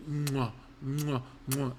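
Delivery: mock smooching noises; soft imitated kisses, "mwah"; kissing sounds. He mimics kissing every girl he dated, smooching.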